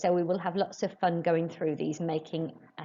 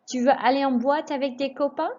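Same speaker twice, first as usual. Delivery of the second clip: higher model, copains rises; rising question tune; 7 semitones higher, 3 dB louder than usual. Shown as a demonstration. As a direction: lift the end on copains. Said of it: "raise your voice just before the question mark".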